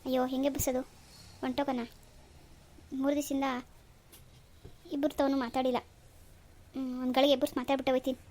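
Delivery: quiet murmured worry; hushed. Acted. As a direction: speak softly near the sleeping child. Hushed talk near a sleeping baby.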